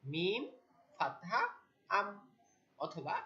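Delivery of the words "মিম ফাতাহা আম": letter by letter, with gaps and rising letter names; spelling out. Shown as a demonstration. Teacher shows learners how to spell, spelling out. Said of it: "আমরা এভাবে বানান করব".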